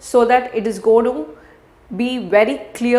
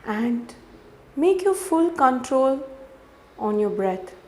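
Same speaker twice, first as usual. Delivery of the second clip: softer, unhurried meditation voice with long pauses; guided meditation; 12 dB quieter than usual. Real, not acted. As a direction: guide the listener gently through each breath.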